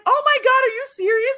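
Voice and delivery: very high, loud disbelief; mock shock. Sister hears aunt is supposedly dead, mock shock. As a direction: play an alarmed sister, hammed up.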